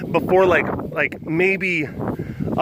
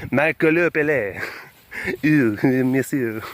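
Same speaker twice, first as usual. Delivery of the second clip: hammed-up French, slowing right down on "monsieur"; mock French accent. Clowning as if in France, mock French accent. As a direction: play a chuckling Frenchman. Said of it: "doing the French accent".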